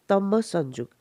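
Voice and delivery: ordinary delivery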